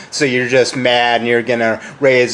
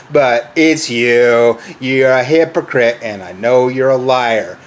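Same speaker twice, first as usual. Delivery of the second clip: ordinary talk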